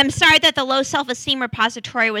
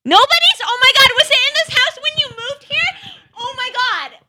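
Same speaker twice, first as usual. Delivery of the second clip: high, loud, breathless disbelief; freaking out about the ghost doll; excited high squeals; 10 semitones higher, 4 dB louder than usual. Real, not acted.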